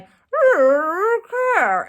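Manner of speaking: high, drawn-out dazed okay. Retold or imitated. Fainted on the floor, answering helpers in a daze. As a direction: answer as if half conscious.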